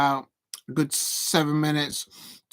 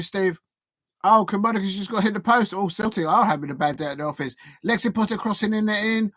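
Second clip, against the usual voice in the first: normal talking voice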